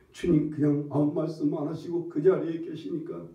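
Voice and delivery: ordinary talk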